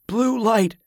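high, weak feverish moan; delirious moan. A narrator's reading. Sick man sagging in his wife's arms, high moan. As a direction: moan it high, half-conscious. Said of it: "he moaned".

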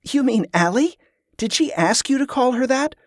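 can't believe what she hears; incredulous. A narrator's reading.

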